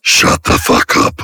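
slow, voiceless rasp; mock rasped retort. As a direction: rasp it slowly without voice. Retort to the heavenly call.

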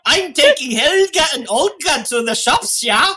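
mock Swedish. Host mimicking co-host's drifting accent, mock Swedish. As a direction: tease with a bouncy Swedish accent. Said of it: "you're sounding more like Swedish".

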